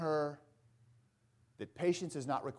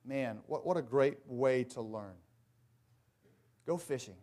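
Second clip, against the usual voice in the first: ordinary talk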